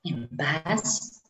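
guided meditation. Acted. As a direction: lead them gently into calm breathing.

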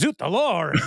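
high, hammed-up French accent through laughter; mock French exclamation. [name] overcommitting to her French character, hammed-up French accent. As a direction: exclaim it in silly pretend French.

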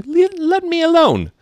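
very high, loud protest; indignant high-pitched cry. Acted. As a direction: burst out in offended objection. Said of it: "said Alice indignantly".

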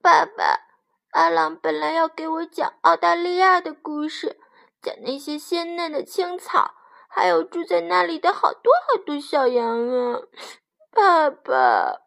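high, plaintive lamb voice; high-pitched wistful child sheep; pleading little lamb. A narrator's reading. Sad lamb pleads with father.

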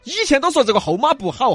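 normal talking voice

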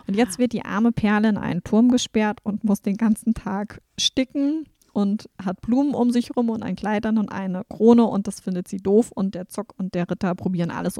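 usual speaking voice

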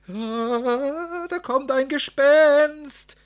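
high, drawn-out shriek of alarm; terrified scream. A narrator's reading. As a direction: scream that a ghost is coming. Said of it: "schrien sie".

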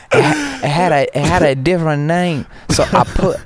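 thick mock Chinese accent, pitched high. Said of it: "Chinese accent".

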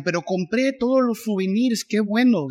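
lightly mock a fan proud of her purchases; mock satisfied fan. Mocking a fan bragging about souvenirs. (leve imitación burlona de fan satisfecha)

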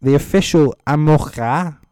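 ordinary talk

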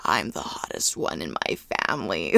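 very low, gravelly drug-wrecked rasp; raspy voice. Retold or imitated. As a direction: croak it out deep and raspy. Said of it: "super raspy voice".

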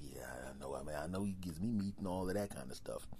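low hushed whisper; lion whispering to the bear Barry; conspiring lion. Acted. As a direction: whisper to a partner in crime. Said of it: "And the lion's like".

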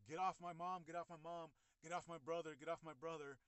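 imitating a sister's repeated yells; urgent protest. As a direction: plead forcefully for them to back off. Sister's retold shouts at police.

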